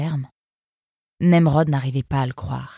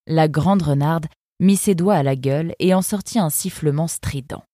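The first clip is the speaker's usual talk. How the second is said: ordinary delivery, nothing marked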